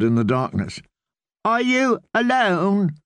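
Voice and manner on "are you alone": put-on high, slow, whiny little boy; a frail child calling from bed; sickly boy voice. Boy lord voiced in story.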